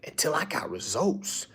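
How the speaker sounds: hushed emphatic whisper. Real, not acted. Speaker to followers, hushed punchline. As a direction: let it land in a whisper.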